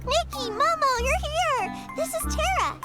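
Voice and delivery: high, delighted welcome; excited greeting. Acted. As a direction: greet friends with bubbly excitement. Greets friends who arrived, makes introductions.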